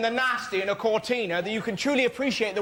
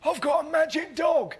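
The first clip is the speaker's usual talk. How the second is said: homeless bloke; 6 semitones higher than usual. Retold or imitated. And he went.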